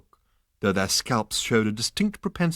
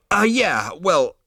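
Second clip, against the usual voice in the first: flustered Zaphod; 9 semitones higher, 6 dB louder, slower than usual. A narrator's reading.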